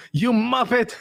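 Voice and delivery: hammed-up Ramsay scolding a theologian; Chef Ramsay insult. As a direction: fling the insult like a furious TV chef. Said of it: "like Chef Ramsay responds".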